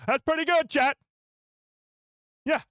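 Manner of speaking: high, loud burst of pride; excited boast. Real, not acted. Streamer bragging to chat after the impression, excited boast. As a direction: call it out high and loud to the viewers.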